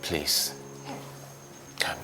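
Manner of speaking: kneeling husband begging his wife not to leave. Acted. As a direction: plead on your knees, broken.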